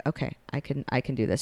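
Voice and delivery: steady yourself after the fall; self-encouraging. Retold or imitated. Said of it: "She's like".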